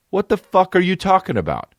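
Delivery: high-pitched exasperated disbelief; comic indignation; incredulous outburst. Retold or imitated. Retold exasperated reply to fan.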